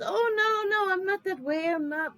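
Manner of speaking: high, hammed-up South American accent; mock South American accent. Rich suitor reassuring Roz, in a mock South American accent. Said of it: "I'm not gonna do the accent anymore".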